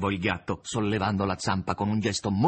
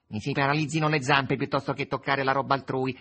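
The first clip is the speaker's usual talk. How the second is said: raised-pitch pompous protest; haughty exclamation; 5 semitones higher, 3 dB louder than usual. A narrator's reading. Cat protests his honesty to Margherita, haughtily. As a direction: declare it with lofty self-importance. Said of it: "esclamò il gatto con sussiego".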